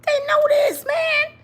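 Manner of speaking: exasperated comic rant; rising exasperation. Real, not acted. Ranting about the men.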